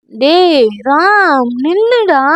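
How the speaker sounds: tipsy drawl rising to a command; drunk call. Acted. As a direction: drag out his name, tipsy. Drunk girl calling him back.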